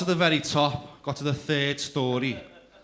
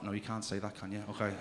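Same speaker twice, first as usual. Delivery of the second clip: ordinary talk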